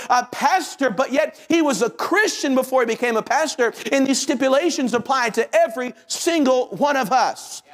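high, driving sermon delivery; impassioned preaching. Real, not acted. Preacher urging the congregation to their task, high and driving.

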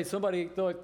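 ordinary talk, nothing marked

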